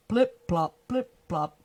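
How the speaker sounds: punchy onomatopoeic plops; wet plopping steps. Acted. Narrator imitating frog creeping up steps, plopping. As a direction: pop each word like a wet footfall.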